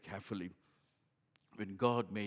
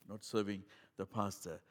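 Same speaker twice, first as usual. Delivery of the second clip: normal talking voice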